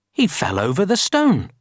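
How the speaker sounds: usual speaking voice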